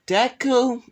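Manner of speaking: real crack up into a high pitch. Real, not acted. Narrator calling out the hero's name, voice cracking. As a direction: let the voice break high on the name. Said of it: "my voice just cracked".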